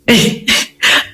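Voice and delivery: soft unvoiced giggle at herself; real breathy giggle. Real, not acted. Therapist laughs at her own story, soft breathy giggle. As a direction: let a quiet laugh slip out. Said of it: "Ay, no, qué risa".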